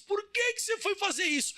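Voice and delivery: high, plaintive dying protest; hammed-up high-pitched last question; dying turtle. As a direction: wail it as the betrayed, dying turtle. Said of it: "ela foi morrendo assim, disse".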